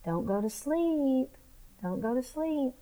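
soft, slow, eerie inner voice; a low murmur from deep in the mind; hushed voice of the brain warning. Retold or imitated. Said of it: "something deep in my brain that says".

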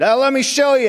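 eager to show off the grandbaby; mock eager grandpa. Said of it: "How's that new grandbaby?".

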